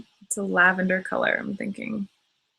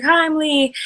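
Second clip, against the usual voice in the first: higher-pitched mock Irish greeting; hint of a fake Irish accent; bad Irish accent; 8 semitones higher, 3 dB louder than usual. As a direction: lightly imitate an Irishman introducing himself, badly. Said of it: "that was a horrible Irish accent".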